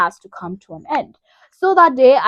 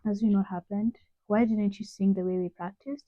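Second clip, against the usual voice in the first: soft concerned questioning; 9 dB quieter than usual. Retold or imitated. Mom questioning her after stage.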